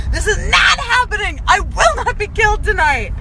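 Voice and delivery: loud, high defiant yell; frantic high shouting refusal; retold scream. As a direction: scream it at the driver. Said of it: "started screaming at this".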